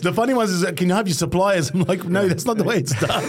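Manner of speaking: high, loud quote through laughter; quoting a silly request, cracking up; laughing. Retold or imitated. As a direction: laugh while retelling. Laughing retelling of odd requests.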